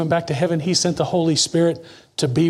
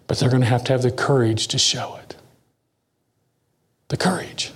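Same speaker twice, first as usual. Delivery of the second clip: preacher's intimate whisper; 5 semitones lower than usual. Real, not acted. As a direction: lean in and whisper the challenge.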